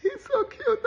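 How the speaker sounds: very high, gushing schoolgirl voice; dreamy swooning girl. Retold or imitated. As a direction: swoon over the boy in a squeaky high voice. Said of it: "every girl is dreaming in their mind".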